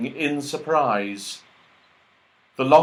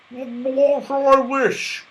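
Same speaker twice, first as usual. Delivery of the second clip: Franny voice; 13 semitones higher, 4 dB louder, much slower than usual. Acted. Franny puzzled.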